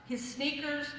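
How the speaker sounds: normal talking voice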